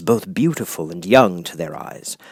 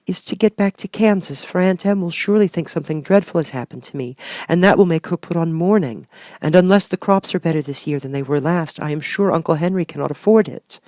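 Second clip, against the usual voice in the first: put-on high, earnest young girl's voice; a small girl longing for home; little girl Dorothy; 8 semitones higher than usual. Dorothy telling the good witch she wants home, girlish and earnest.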